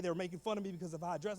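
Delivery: ordinary talk, nothing marked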